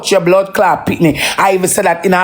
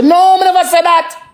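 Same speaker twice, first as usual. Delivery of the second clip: daughter denying; 11 semitones higher than usual. Retold or imitated. Father quoting his daughter's denial, higher voice. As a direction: voice the girl insisting she never said it.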